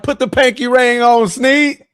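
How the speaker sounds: hyped cheer. Retold or imitated. Fan urging Snead to put on the ring, hyped. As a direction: cheer him on like a fan shouting. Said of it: "I'm like".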